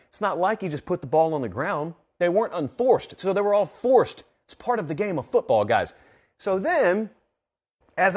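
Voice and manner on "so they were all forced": forced hit high and loud; stress on forced. Shown as a demonstration. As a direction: punch the word forced. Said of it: "I wanna emphasize the word forced".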